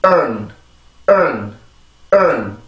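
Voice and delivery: 은 ending very short and weak; drilled "earn" ending. Shown as a demonstration. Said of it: "다시 한번".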